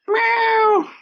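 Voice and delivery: very high, loud mock cat meow; a silly, squealing kitty voice. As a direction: yowl it like a cartoon cat. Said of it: "It's a whisker voice".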